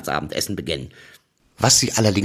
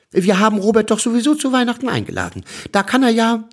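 higher, brighter mother voice; 10 semitones higher, 3 dB louder than usual. A narrator's reading. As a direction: speak as the mother, lightly raised. Mama spricht mit Papa. (Mutterstimme, höher)

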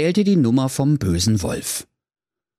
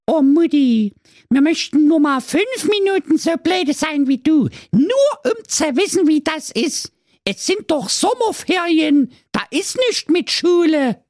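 very high, louder sassy little goat voices; cheeky goat kids; 4 dB louder than usual. A narrator's reading. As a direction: talk back to mum, cheeky and squeaky. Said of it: "Die sieben lieben Geißlein aber sprachen".

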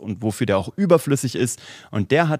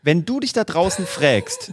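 slow, higher quote with a Bavarian "frägst"; imitating Bavarian dialect, raised and slowed; imitated Bavarian slip; 5 semitones higher than usual. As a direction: mimic a colleague's Bavarian slip, drawn out. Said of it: "natürlich einen bayerischen Slang mitbringt".

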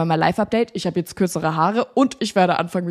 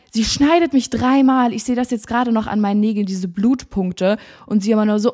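ordinary talk

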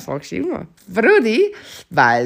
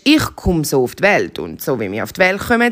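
ordinary delivery

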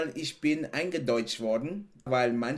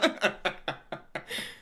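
breathy laugh; 5 semitones higher, 3 dB quieter than usual. Real, not acted.

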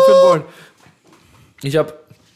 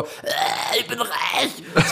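smug rich voice; 8 semitones higher, much slower than usual. Retold or imitated. Mock rich gamer voice.

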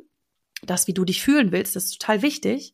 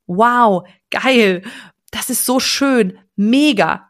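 louder, gushing wow and geil; excited exclamations; 4 dB louder, slower than usual. Shown as a demonstration. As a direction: blurt it out thrilled. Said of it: "Ich rede halt so".

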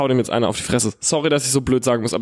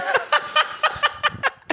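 short high-pitched laugh; 3 dB quieter than usual. Real, not acted. Laughing at his own confusion while telling the story. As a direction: crack up, high and light.

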